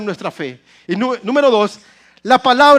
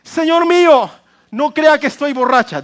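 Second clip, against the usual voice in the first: higher, louder lead line for the church to repeat; call and response; 7 semitones higher, 6 dB louder than usual. Shown as a demonstration. Pastor leading the congregation to repeat the verse, louder and higher. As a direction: belt it out so everyone repeats it. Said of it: "a ver, diga conmigo".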